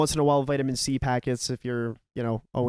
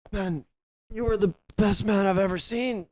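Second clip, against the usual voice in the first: put-on aggressively nice drunk; 5 semitones higher, slower than usual. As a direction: mimic a drunk friend heaping on praise. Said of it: "so aggressively nice when he's drunk".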